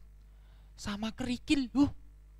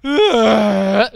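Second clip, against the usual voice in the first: blaring, ugly donkey bray; loud bray passing for singing; donkey singing attempt; 13 dB louder than usual. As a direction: belt out a terrible note, very loud. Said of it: "Nyanyi lagi".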